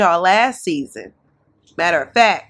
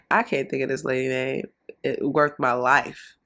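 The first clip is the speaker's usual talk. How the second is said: normal talking voice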